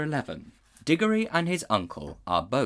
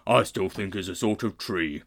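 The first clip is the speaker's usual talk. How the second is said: warthog. A narrator's reading. Warthog declaring the fainted man a tree. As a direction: grunt it like a stolid warthog.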